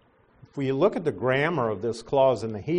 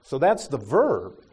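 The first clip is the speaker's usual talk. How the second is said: ordinary talk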